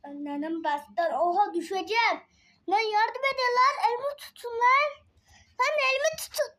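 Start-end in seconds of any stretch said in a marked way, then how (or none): 2.7-6.5 yelling for help. Real, not acted. Calling chat for help while falling.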